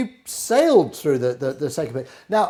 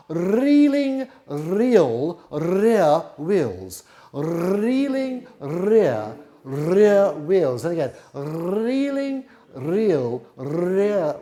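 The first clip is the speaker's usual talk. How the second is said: very slow, leaning on every R; slow over-articulated; much slower than usual. Shown as a demonstration. Flute teacher drilling R sounds, slow and exaggerated. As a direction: stretch each word for tongue practice. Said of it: "there's some lovely r's going on".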